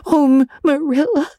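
high, broken sob; sobbing. A narrator's reading. Anne on her bed, sobbing to Marilla. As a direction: choke out the name through tears. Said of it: "sobbed Anne".

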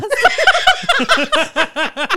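loud high burst of real laughter. Real, not acted. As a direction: crack up at the friend's joke.

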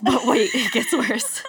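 slow, laughing teaser line; hammed-up catchphrase. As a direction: sell it like a podcast catchphrase. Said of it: "That's gonna be the catchphrase".

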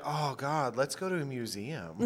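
low, soft mock-weary mumble; imagined date's aside. Host imagines the disappointed date, quietly deadpan. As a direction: murmur it as the underwhelmed date. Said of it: "sounds like maybe he was like".

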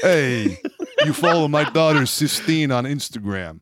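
a hint of a tough guy; lightly voiced Italian-American bro; gym bro voice. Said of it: "a bro voice".

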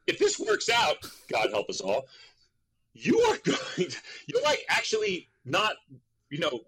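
father lecturing son on the union, lightly mocked and high; lecturing dad. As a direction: explain it like an exasperated father. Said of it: "And he goes".

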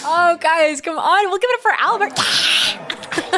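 high, gushing crowd call; rallying applause. Pretend. Host asks audience to applaud her producer, high and gushing. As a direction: sing out encouragement to the audience.

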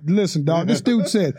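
normal talking voice

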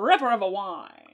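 put-on punchy old-time ad read; 1930s voice. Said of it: "nineteen thirties voice".

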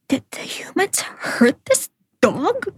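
soft, high, breathless whisper; asking it under her breath, afraid of the answer; hushed worry. Retold or imitated. To the host, recalling her fear, whispering. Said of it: "I was really feeling a lot of the pain".

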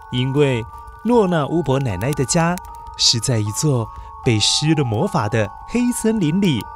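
usual speaking voice

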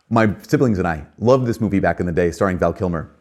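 ordinary talk

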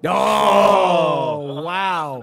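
long, loud crowing "oh"; loud reaction. Real, not acted. Friend reacting to the Bang punchline, loud reaction. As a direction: holler it, bowled over by the joke.

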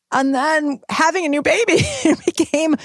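laughing. Real, not acted. Laughs at the new-baby chaos.

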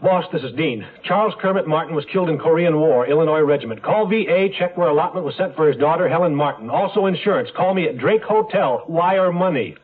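high, rapid-patter phone message; disc jockey. Acted. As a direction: reel it off like a radio DJ. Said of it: "you sound like a disc jockey".

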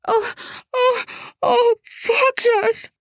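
high, slow moaning, muffled; muffled by cushion. Acted. Woman moaning into a couch cushion, muffled and high. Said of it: "her voice now muffled by a couch cushion".

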